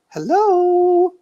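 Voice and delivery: very high, loud mock woman's "Hello"; ghostly woman's hello. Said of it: "Like that".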